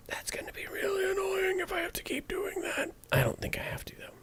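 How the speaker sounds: soft whispered grumble to himself; whispered aside. Real, not acted. Mixing engineer mutters to himself in a whisper. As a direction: breathe it out under your breath.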